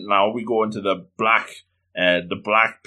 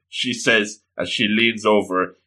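ordinary delivery

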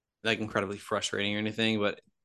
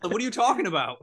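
hammed-up incredulous protest; mock incredulity; 10 semitones higher, 3 dB louder than usual. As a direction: call him out in exaggerated bafflement. Acting out teammates' incredulous reply.

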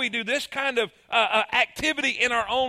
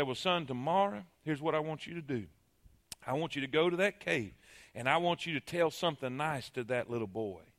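low, soft motherly voice; calm, knowing mother; mama gently advising her son; wise mama; 7 semitones lower, 9 dB quieter than usual. Acted. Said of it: "His mama understands".

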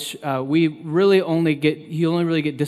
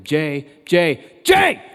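dad yelling; 2 semitones higher, 7 dB louder, much slower than usual. Retold or imitated. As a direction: call out, then yell the last name at full force. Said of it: "I wouldn't have to yell".

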